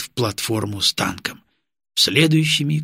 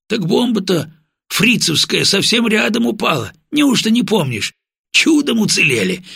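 excited explanation; 9 semitones higher than usual. A narrator's reading. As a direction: tell it with amazed excitement. Comrade excitedly explaining survival.

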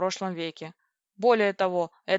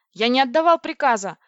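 loud, higher outraged shout; furious raised voice; angry bark; 5 semitones higher, 9 dB louder than usual. A narrator's reading. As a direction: roar it like an ignored captain. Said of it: "рявкнул Владлин".

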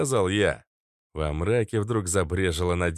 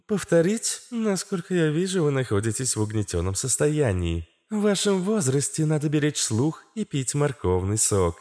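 high, chirpy female voice; chirping; 6 semitones higher than usual. A narrator's reading. Astra advises old Katz on his hearing, high chirping voice. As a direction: chirp it in a raised register. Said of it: "прощебетала Астра".

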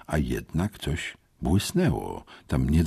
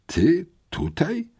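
high, slow, halting question; choked-out surprise; slower than usual. A narrator's reading. Boy meets a schoolmate he didn't expect, choking out the question. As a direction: force it out in startled disbelief. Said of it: "wykrztusiłem".

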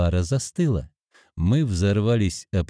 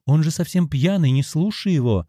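high, urgent, mildly alarmed; frightened exclamation; 6 semitones higher than usual. A narrator's reading. Woman urges him to ignore the drunk, frightened plea. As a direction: plead with a touch of fright. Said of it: "испуганно воскликнула Ольга".

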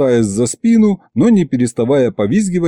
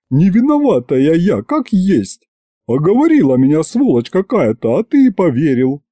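trembling plea; 5 semitones higher than usual. A narrator's reading. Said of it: "губы задрожали".

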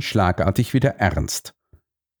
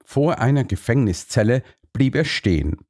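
plain reading voice

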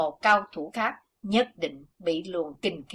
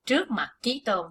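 usual speaking voice

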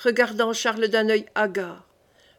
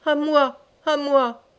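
higher-pitched, understated plea for rescue; cry for help; 4 semitones higher, slower than usual. A narrator's reading. Collapsed Charles calls for help, mildly alarmed cry. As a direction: call out in alarm, voice raised in pitch.

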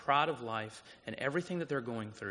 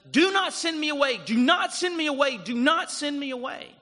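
much higher, much louder begging shout; a foster boy screaming at his new dad; desperate pleading cry; 14 semitones higher, 11 dB louder than usual. Retold or imitated. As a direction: beg not to be sent away, wailing. Orphan boy pleading under bed.

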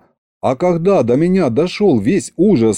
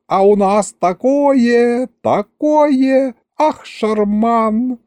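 put-on high, drawn-out vampire girl's delight; elated squeal; 11 semitones higher, much slower than usual. A narrator's reading. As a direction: gush in a high voice, stretching the words. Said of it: "восторженно хлопая в ладоши".

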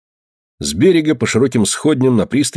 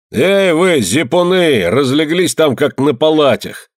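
loud, high rough shout; 7 semitones higher, 3 dB louder, slower than usual. A narrator's reading. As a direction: bark it out roughly like a ship's mate. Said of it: "послышался грубоватый голос".

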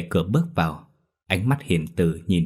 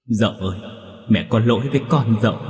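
acted weeping, pitched up in grief; tearful lament; 3 semitones higher than usual. A narrator's reading. Mother embraces her dead son, weeping with guilt. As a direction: wail your remorse to your child. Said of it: "một mẹ một con ôm nhau mà khóc nức nở".

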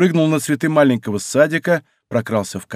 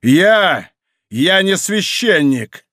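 high, drawn-out, forceful declaration; торжествующее разоблачение; 6 semitones higher, 3 dB louder, much slower than usual. A narrator's reading. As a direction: hurl the revelation at him. Monk triumphantly reveals he is no priest. (торжествующе, нараспев)

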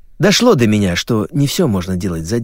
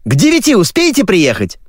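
high, pressing woman's cry; barrage of shouting; 11 semitones higher, 4 dB louder than usual. Acted. TV caller pressing him to come by nine, shouting. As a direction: call the question out high. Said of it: "слились в одну канонаду".

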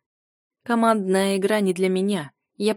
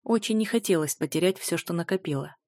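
ordinary delivery, nothing marked

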